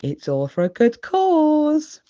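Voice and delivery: Miss Rabbit. A narrator's reading. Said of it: "says Miss Rabbit".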